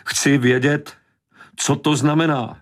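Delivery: high, insistent angry demand; outraged, high-pitched woman; shouted demand. A narrator's reading. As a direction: insist on an answer, pitched right up. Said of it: "Křičela".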